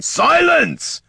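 explosive, high, loud command; roar. A narrator's reading. Tyron cuts off the bickering undead, roaring. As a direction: roar over everyone to stop them. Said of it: "roared Tyron".

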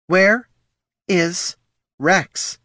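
high, loud stress on Where, then is Rex; repeated demand. A narrator's reading. Mother demanding the pups tell her, stern. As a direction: hammer the question again. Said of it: "Missus Herc repeated".

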